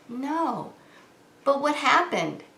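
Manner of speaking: ordinary talk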